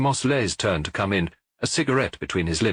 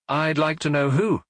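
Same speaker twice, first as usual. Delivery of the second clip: retort; 8 semitones higher than usual. A narrator's reading. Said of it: "she retorted".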